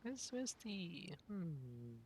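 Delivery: ordinary delivery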